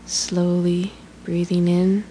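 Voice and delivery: ordinary delivery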